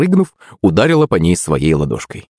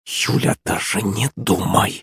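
hissed warning; 2 semitones higher, 6 dB quieter, slower than usual. A narrator's reading. Narrator warning Yulenka in her ear, hissing. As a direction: hiss it slowly into someone's ear. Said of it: "прошипел я ей на ухо".

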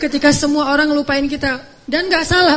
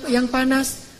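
normal talking voice

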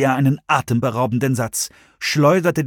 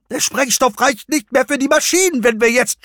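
much higher panicked pleading; voice cracking; 12 semitones higher than usual. A narrator's reading. Nick pleading with Jones, voice cracking. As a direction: near breaking, talking over himself. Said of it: "Nicks Stimme überschlug sich fast".

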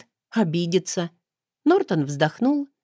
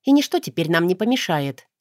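usual speaking voice

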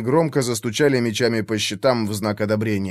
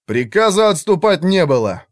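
firm, cold statement, slightly raised; cold rebuke; 5 semitones higher, 4 dB louder than usual. A narrator's reading. Redhead blocks fleeing mage, cold and firm. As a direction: shut down the panic coldly. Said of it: "холодно произнёс Рыжий".

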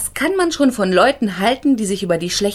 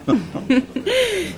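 a short laugh that falls away; teasing laugh; 8 semitones higher than usual. Acted. Teasing the lawyer about the crying women, laughing. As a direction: laugh at the tearful jurors.

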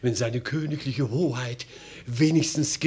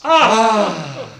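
delighted gasp; 9 semitones higher, 9 dB louder than usual. Acted. Reaction to news of a prince.

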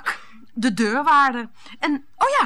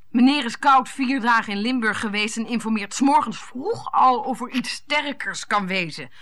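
ordinary talk

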